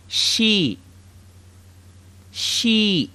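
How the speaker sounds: "She" repeated as a clear Arabic shi; shi demo. Shown as a demonstration. Teacher repeats shi for students to echo. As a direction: model "She" for echoing. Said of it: "Say it with me".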